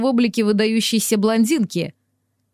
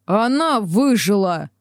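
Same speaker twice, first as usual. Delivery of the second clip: slow, hoarse rasped exclamation; slower than usual. Acted. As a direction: rasp it out through a sore throat. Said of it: "просипела я".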